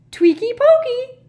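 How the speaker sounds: gleeful, very high shriek; loud squealing poltergeist taunt; Peeves. Acted. Poltergeist pelting elves to enrage them, gleeful shriek. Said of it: "cried Peeves happily".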